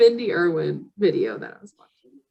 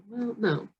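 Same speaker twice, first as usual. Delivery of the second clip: ordinary talk, nothing marked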